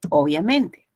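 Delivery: ordinary talk, nothing marked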